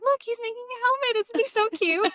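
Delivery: high, fast, cooing delight; gushing mom. Retold or imitated. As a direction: coo over the grandson.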